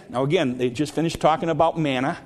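ordinary talk, nothing marked

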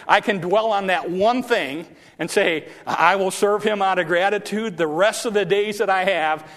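fervent declaration. Real, not acted. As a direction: proclaim the vow with force. Preacher declares his own vow.

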